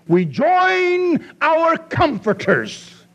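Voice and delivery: high, slow sarcastic drawl. Shown as a demonstration. Pastor mocking Job's so-called comforters, slow and sarcastic. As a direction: sneer it out like a mock compliment. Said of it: "said sarcastically".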